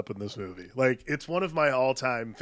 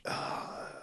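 long, hushed mock-exasperated sigh; fed-up sigh; 10 dB quieter than usual. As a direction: breathe out like a movie hero who has had enough. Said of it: "he's, like, had enough".

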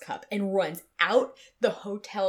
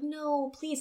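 mock pleading; 7 semitones higher, 3 dB quieter than usual. Pleading back with client.

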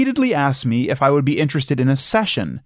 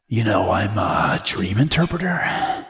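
creepy stranger voice; 5 semitones lower than usual. Pretend. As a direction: play a creepy stranger with a low voice. Acting creepy dog-park stranger.

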